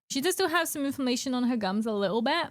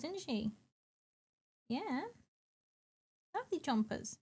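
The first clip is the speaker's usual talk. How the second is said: soft pet talk; 5 dB quieter than usual. Real, not acted. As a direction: coo softly to a cat back from the vet. Cooing to the cat.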